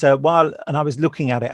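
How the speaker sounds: usual speaking voice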